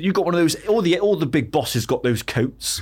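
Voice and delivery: put-on a young lad from Bristol with a Bristolian accent, pitched high. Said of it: "I'll do my best Bristolian accent".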